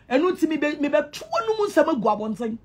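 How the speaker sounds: ordinary delivery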